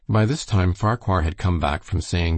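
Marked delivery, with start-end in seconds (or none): none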